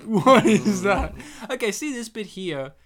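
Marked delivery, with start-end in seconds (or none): 0.1-1.2 louder, laughing through the question; laughing disbelief. Retold or imitated. Reacting to a strange movie accent, laughing loudly. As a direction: crack up as you repeat it.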